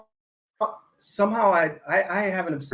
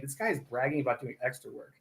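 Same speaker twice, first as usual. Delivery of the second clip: soft, laughing mock voice of people making fun of him; mocking, laughing quietly; laughing mockery; 4 semitones lower, 9 dB quieter than usual. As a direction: say it through a chuckle as the mocking audience. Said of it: "people are laughing their ass off saying".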